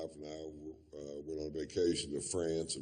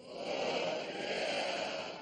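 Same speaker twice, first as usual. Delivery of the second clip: crowd answer. Shown as a demonstration. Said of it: "let's try it one time".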